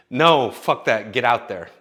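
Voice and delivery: loud, slow, emphatic. Real, not acted. Creator urges viewers to stop waiting, loud and slow. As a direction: hammer it home to the viewers.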